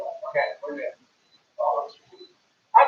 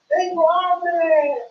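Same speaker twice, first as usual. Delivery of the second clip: slow teaching model; 9 semitones higher, 3 dB louder, much slower than usual. Shown as a demonstration. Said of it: "say that, please".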